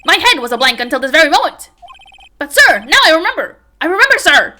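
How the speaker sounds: loud, high, frantic patrolman yelling sir; shouty patrolman. Acted. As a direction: bellow every line like a panicked rookie cop.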